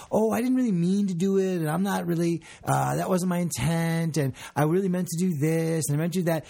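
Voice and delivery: dance around the apology, imitating; mock excuse-making. Imitating someone who won't say sorry, excuse-making. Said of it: "They sort of dance around".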